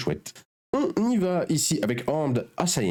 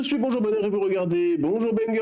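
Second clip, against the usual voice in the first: bright, high show-opening voice; energetic announcer welcome; show host intro; 9 semitones higher than usual. Acted. Channel intro catchphrase.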